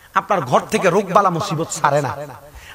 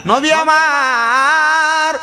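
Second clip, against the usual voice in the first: melodic waz preaching; 4 dB louder, slower than usual. Acted. Preacher tells congregation of a weeping grave, chanted sermon. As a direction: preach the tale in singsong, stretching the line endings.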